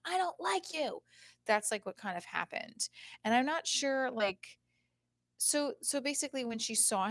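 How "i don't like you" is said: high-pitched mock kid retort; imitated indignant child. Mom retells daughter rejecting a boy, imitated indignant child. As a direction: voice a little girl protesting, pitched up.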